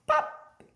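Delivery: high sharp "pop!"; cheerful high pop noise; popping sound effect. Acted. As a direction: make the button pop with a bright squeak. Last button pops.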